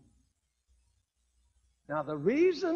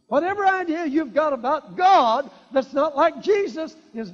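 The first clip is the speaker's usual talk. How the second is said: full-force preaching; 7 semitones higher, 10 dB louder than usual. Real, not acted. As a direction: thunder it from the pulpit.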